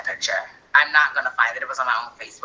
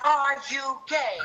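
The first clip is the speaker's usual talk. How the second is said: a drawn-out, higher mock of mom asking again; slow pointed question; 9 semitones higher, much slower than usual. Son retelling his mom asking again, slow and pointed. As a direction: stretch each word like a suspicious mother.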